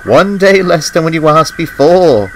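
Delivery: amused. A narrator's reading. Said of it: "laughed his driver".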